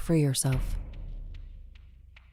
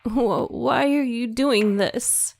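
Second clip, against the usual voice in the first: high, slow, halting sobbed question; a frightened sister sobbing at her brother; 8 semitones higher, 3 dB louder, much slower than usual. A narrator's reading. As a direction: plead through tears, stumbling on the first word. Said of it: "she sobbed".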